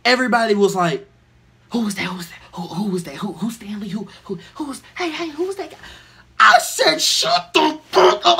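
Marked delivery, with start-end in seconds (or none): 1.7-5.8 soft, hushed, confused whisper. Retold or imitated. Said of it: "They were whispering". 7.0-8.4 angry yell. Retold or imitated. Yelling at the crowd.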